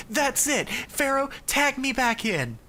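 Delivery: high-pitched fed-up outburst; indignant little Yugi. Retold or imitated. Little Yugi demanding the pharaoh swap back, high indignation. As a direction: pipe up high, had enough.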